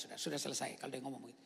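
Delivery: ordinary talk, nothing marked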